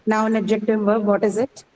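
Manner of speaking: usual speaking voice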